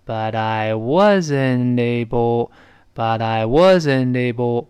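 very slow, drawn-out linking practice; slow-motion teacher's model; slowed-down demo. Shown as a demonstration. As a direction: stretch each syllable while keeping it linked. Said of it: "就慢一点儿呗".

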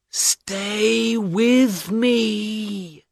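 fading, begging him to stay; weak plea. A narrator's reading. Wounded Mina begging her husband, very slow soft plea. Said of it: "she cried".

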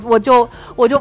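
ordinary talk, nothing marked

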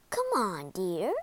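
calm, low-key coaxing; gentle queen. Acted. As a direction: coax a loved one gently. Queen gently coaxes the king.

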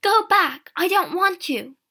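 loud high dismissal; crying out. A narrator's reading. Sister sending the younger one back, crying out. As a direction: cry out to send them away. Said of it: "Alison cried".

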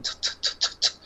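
"特" as repeated breathy unvoiced T puffs; voiceless T demo; whispered T bursts. Shown as a demonstration. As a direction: puff out "特" to show air waste. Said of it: "那特的音".

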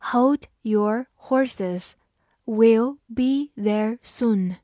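very slow, word by word; slow read-through. Shown as a demonstration. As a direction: spell the sentence out word by word, unhurried. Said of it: "好，我们慢读一遍". (慢读一遍，逐词停顿)

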